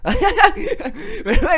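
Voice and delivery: normal talking voice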